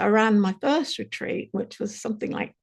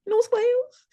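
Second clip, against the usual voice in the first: excited rising echo. Retold or imitated. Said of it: "And I just went".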